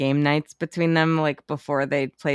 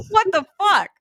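high-pitched disbelief; mock outrage at the clue; baffled what-the-fuck, pitched way up; incredulous. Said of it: "retelling reaction".

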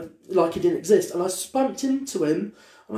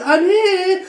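camp friend; 12 semitones higher, 7 dB louder than usual. Retold or imitated.

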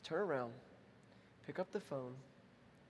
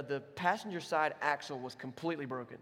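ordinary talk, nothing marked

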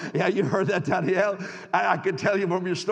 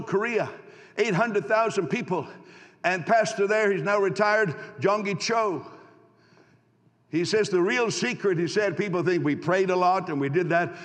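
rousing preaching; 3 semitones higher, 3 dB louder than usual. Acted. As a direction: preach it out and call for applause. Rousing the congregation.

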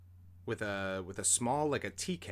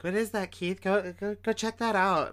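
high, louder imitation of girls urging; urgent girls; 10 semitones higher, 6 dB louder than usual. Girls to Keith about the light, high-pitched urging. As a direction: push him to go look, pitched up high.

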